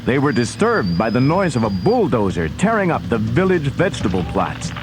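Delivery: ordinary delivery, nothing marked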